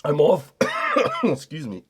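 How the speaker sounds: real coughing fit breaking up the words; choking on smoke. Real, not acted. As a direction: try to talk through a cough after too big a hit. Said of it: "That was way too big a hit".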